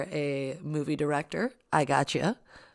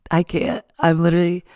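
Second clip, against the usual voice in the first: much louder, breaking out of the whisper; loud burst after failed ASMR whisper; loud laughing outburst; 12 dB louder than usual. Real, not acted. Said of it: "I can't be that quiet".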